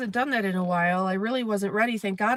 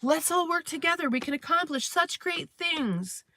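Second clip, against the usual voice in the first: chirpy team player; 7 semitones higher than usual. Retold or imitated. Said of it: "I'm like a person like".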